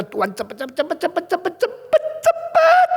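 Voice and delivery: rapid repeats climbing to a very high squeal; mock frantic prayer, pitch rising step by step; impatient begging. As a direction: start urgent, end shrieking high and loud.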